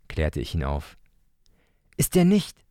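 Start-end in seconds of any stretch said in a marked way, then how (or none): 2.0-2.6 hoch und lauter, protestierende Kinderfrage; empört widersprechen wie ein kleiner Junge; widersprechender kleiner Bruder. Acted. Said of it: "widersprach Leo". (hoher, lauter Kinderprotest)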